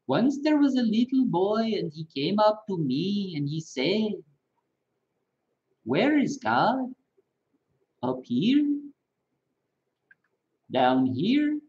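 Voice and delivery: put-on parable voice in an Indian accent, rising on up here; Prem Rawat impression. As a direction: guru voice with Indian accent asking where God is. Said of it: "I can do an, an Indian accent pretty good".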